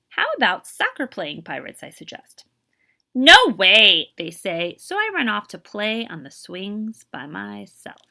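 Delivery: lively, expressive picture-book reading; teacher reading a storybook to young kids; reading to children. Acted. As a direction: read it aloud to a class, bringing each line to life. Teacher reads picture book aloud.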